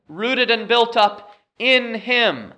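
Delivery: slow, high reading with "in" punched; stress on "in". Shown as a demonstration. Preacher reads verse seven, stressing "in". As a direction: hold back, then lean on "in" and drop on "him". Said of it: "emphasizing the word in".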